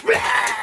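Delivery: high, sudden mock roar. Imitating the crazy lady lunging past a guy, mock roar. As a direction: burst out with a mock "Rah!". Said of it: "she went".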